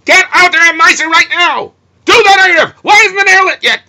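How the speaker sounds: angry yelling. Shown as a demonstration. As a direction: bark the orders at the household like a tyrant. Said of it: "you're not supposed to say".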